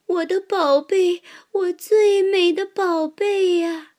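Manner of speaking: high, loud doting squirrel voice; squirrel talking to itself. A narrator's reading. Squirrel gloats over its acorn pile, doting. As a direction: croon over the acorns like a miser. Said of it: "它自言自语着".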